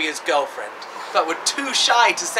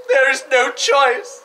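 slow, higher, louder hammed-up sobbing words; fake crying; 7 semitones higher, 5 dB louder, slower than usual. Mum telling Jack there is no choice, hammed-up crying. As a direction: blub it out like a panto dame. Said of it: "I'm crying".

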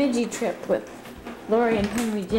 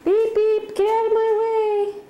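playful honking; 8 semitones higher than usual. Pretend. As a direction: pretend to be a honking car. Playful toy car voice.

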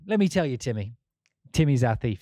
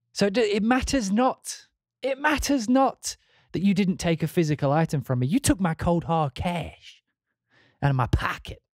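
a hint of a slick American salesman; little American accent; 4 semitones higher than usual. Lecturing Timmy in a light American accent. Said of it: "in a little American accent".

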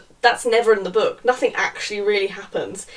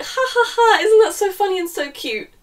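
high, loud mock cutesy voice; hammed-up fake giggling delight; mock the couple's cuteness, high and loud; mocking fake laugh; 10 semitones higher, 5 dB louder than usual. Mocking the couple's cute banter.

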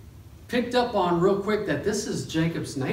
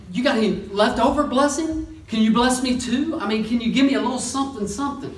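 higher, fast pleading mock voice; begging; 6 semitones higher, faster than usual. Esau begging his father for any leftover blessing, higher pleading. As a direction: wheedle for scraps.

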